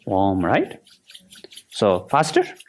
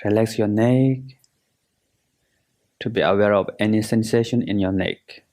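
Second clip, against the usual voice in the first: guided meditation. Acted. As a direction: guide them through relaxing, unhurried and measured.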